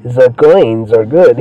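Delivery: slow, put-on German accent; mock German accent. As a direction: do a slow, bad German character. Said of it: "He's not even German".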